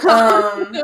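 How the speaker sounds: loud laughter spilling over the words; laughing hard. Real, not acted. Teasing her friend, laughing hard through the words. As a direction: crack up mid-line.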